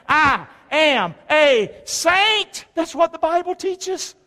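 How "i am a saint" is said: very slow, higher, ringing out "saint"; slow triumphant declaration. Shown as a demonstration. Preacher leading a repeat, triumphant and slow. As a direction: stretch "saint" out like a victory cry. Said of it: "say it with me again".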